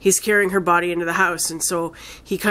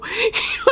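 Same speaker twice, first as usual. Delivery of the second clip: high laugh. Real, not acted. Laughs recalling film ending.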